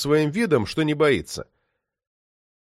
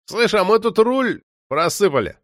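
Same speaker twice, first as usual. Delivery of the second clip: higher, whining street-thug grievance; complain, pitched up, putting it on; mock complaint; 7 semitones higher than usual. A narrator's reading. Moksha complaining to Artyom instead of a reply, mock whine. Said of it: "пожаловался Мокша".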